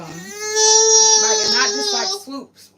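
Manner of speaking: high, loud sob-laden voice; wailing through tears; crying out. Real, not acted. Breaks down mid-sentence.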